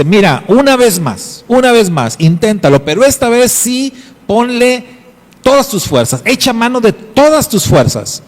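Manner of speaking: voz de padre insistente, bastante más aguda; padre exhortando. Acted. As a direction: insiste con energía, una vez más. Padre exhorta al hijo con fuerza. (padre exhorta al hijo a usar todas sus fuerzas)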